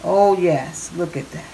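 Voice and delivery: ordinary delivery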